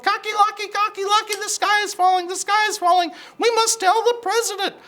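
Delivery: very high, loud, frantic chicken voice; panicked clucking hen. Acted.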